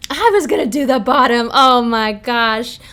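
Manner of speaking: loud, startled and thrilled; excited outburst. Real, not acted. Streamer reacting to a viewer's card, loud excited outburst. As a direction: blurt it out, caught off guard.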